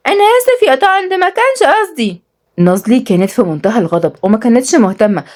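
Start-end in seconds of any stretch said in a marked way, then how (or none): 0.1-2.3 fake innocence. Boy apologizing to the angry guest, fake-innocent child voice. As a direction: play the sweet innocent child, drawing it out. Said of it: "ياسين ببراءة مزيفة".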